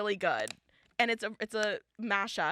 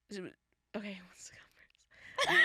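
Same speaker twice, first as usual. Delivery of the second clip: whispering; 2 semitones lower, 3 dB quieter than usual. Real, not acted. Opening the ranking, hushed.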